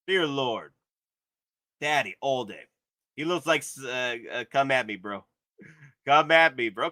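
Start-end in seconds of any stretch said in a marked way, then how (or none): none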